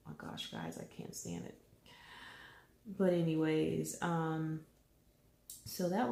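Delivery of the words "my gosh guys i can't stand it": whispered complaint. Real, not acted. Mom telling viewers about the itch, whispered exasperation.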